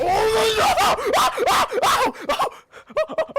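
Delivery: shouting in shock at the play; sudden high excited screams; grenade warning yell. Real, not acted. Caster reacting to grenade play.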